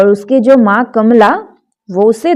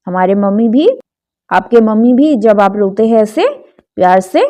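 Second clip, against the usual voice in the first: ordinary talk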